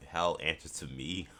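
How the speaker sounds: imitating Doom. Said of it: "he's like".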